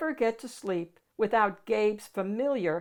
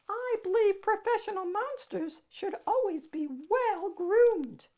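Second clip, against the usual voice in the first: much higher, silky and smooth; high silky monster; 12 semitones higher, 4 dB louder than usual. Acted. Ralph greeting the child, high silky monster voice. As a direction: purr it out, very high and refined. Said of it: "high, silky voice".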